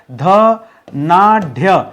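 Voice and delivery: धनाढ्य drawn out very slowly, every sound separate; careful spelling-out. Shown as a demonstration. Teacher slowly sounding out धनाढ्य for students. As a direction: stretch धनाढ्य syllable by syllable so each sound is heard. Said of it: "यहाँ ढ होता है".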